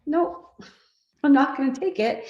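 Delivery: high, light and firm nope; calm refusal. Shown as a demonstration. Daughter declining her mother's blame, calm and light. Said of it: "I'm curious and I'm calm".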